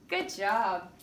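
usual speaking voice

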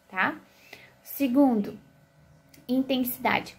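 ordinary talk, nothing marked

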